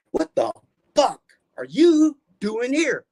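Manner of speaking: high, loud and slow outrage; angry boss. Retold or imitated. Nurse firing Doug at the door, high and furious. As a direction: snap at the employee who showed up. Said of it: "and she goes".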